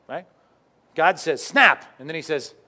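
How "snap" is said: loud, high, abrupt "snap"; snapping noise. Retold or imitated. God disciplining, breaking the arm, loud imitated snap. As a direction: shout it as a sudden breaking sound.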